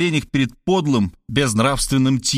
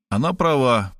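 plain reading voice

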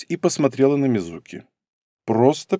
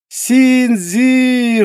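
bright, loud, high greeting; excited shout; 12 semitones higher, 5 dB louder than usual. Acted. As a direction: call his name out joyfully. Girl bursts in, greets him.

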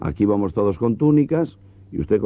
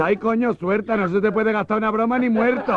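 very high camp voice, half laughing; camp falsetto; 12 semitones higher than usual. Acted. Mourner caught by the coffin.

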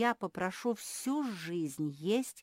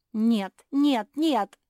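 very loud, high protest; yelling; 7 semitones higher, 15 dB louder, much slower than usual. A narrator's reading. Sister rejecting the suggestion, yelling. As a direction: yell each refusal in protest. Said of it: "завопила Жози".

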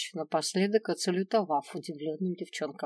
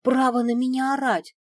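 high, louder indignant protest; affronted, pitched-up exclamation; 6 semitones higher, 5 dB louder than usual. A narrator's reading. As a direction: rise into outraged disbelief. Indignant after being shouted at.

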